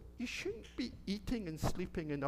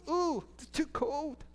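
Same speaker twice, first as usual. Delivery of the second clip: put-on high and slow, a little girl complaining; high, slow Goldilocks; 10 semitones higher, 4 dB louder, slower than usual. Storyteller voicing Goldilocks for the children, high and slow. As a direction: let the girl voice lift high and drag. Goldilocks tastes cold porridge.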